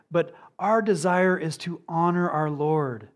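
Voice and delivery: usual speaking voice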